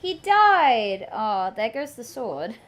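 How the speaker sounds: ordinary talk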